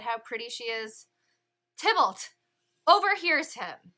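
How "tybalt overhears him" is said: dramatic announcement. Real, not acted. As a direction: announce the villain with a loud flourish. Dramatic buildup in lesson.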